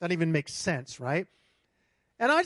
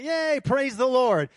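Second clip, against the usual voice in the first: high, loud cheer; a cheering yay as they rush out; mock cheer; 5 semitones higher, 6 dB louder, slower than usual. Churchgoers rushing to ski, cheering.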